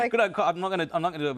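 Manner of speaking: high, loud, laughing protest; embarrassed laughing protest; laughing refusal. Real, not acted. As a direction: refuse through a burst of laughter. Pressed to imitate her grandmother.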